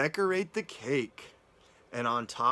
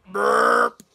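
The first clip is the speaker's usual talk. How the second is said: loud imitated burp; mock burp; 3 semitones higher, 10 dB louder than usual. Storyteller voicing Mouse after cake, loud fake burp. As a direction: burp it out loudly.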